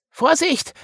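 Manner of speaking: very high, loud alarmed call; squeaky little character's urgent shout; warning cry. A narrator's reading. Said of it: "rief Wiggle".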